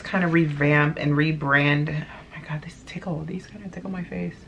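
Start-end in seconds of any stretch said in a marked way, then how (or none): 1.9-4.3 giggly whispered aside. Real, not acted. Vlogger whose hair clips tickle her face, a whispered aside.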